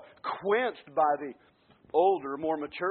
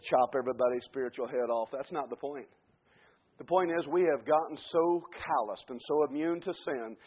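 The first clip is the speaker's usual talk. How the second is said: usual speaking voice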